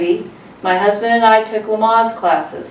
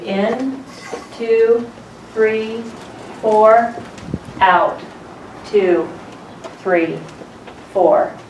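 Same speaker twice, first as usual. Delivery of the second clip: measured paced count with pauses between numbers; spaced-out breathing-coach count; slow breathing count; much slower than usual. Shown as a demonstration. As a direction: count in and out slowly, one beat per number. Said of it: "a kind of breathing that's a deep chest breathing".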